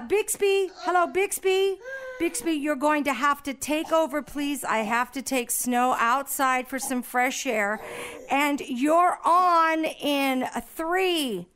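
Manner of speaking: ordinary talk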